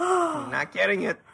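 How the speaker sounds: high, insistent protest; playfully combative denial; aggressive refusal. Real, not acted. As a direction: refuse it firmly, pitch jumping up. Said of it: "I'm being very aggressive".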